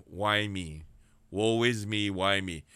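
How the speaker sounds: low, mock self-pitying moan of woe; mock lament. Preacher imitating a despairing prisoner, low lament. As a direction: voice the prisoner feeling sorry for himself.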